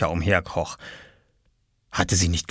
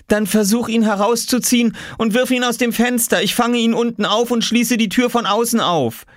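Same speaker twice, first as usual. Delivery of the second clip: high, insistent raised voice; calling instructions; 14 semitones higher, 3 dB louder than usual. A narrator's reading. Mother telling her son to throw the key down, calling. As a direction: call each step through the door.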